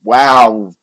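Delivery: loud, startled "wow"; surprised wow. Real, not acted. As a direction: blurt it out in shock. Reacts to a big price move.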